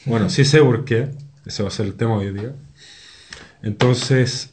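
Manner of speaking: normal talking voice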